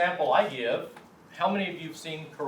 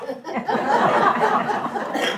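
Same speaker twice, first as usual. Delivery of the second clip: high loud burst of laughter; real laugh; 7 dB louder than usual. Real, not acted. Teacher laughing at a student's cow mistake, big laugh.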